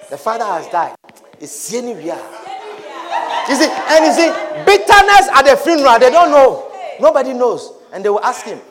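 ordinary delivery

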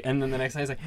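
ordinary talk

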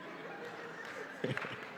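light airy chuckle; high breathy laugh. Real, not acted. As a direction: laugh softly at the joke. Laugh after joke.

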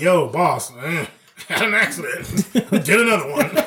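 slow, sheepish confession through laughter; a chuckling, sheepish mock admission; laughing mock confession. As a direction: own up to the boss while cracking up. Pretend confession to boss, laughing.

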